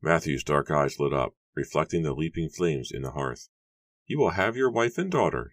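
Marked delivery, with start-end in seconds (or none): 4.1-5.5 high-pitched, unemotional character voice; high, matter-of-fact bargainer; Matthew speaking flatly. A narrator's reading. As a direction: answer coolly in a raised register. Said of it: "he said flatly".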